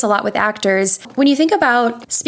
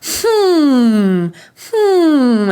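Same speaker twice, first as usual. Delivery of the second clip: chest hum; 4 semitones higher, 4 dB louder than usual. Shown as a demonstration. Coach showing the chest-voice hum exercise. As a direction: hum from the chest, feel it buzz. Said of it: "start with your chest voice".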